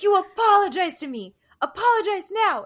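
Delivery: high, loud, tearful demand; mock crying outburst; imitating a furious demand. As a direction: shriek the demand like a wronged girlfriend. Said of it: "And she's like".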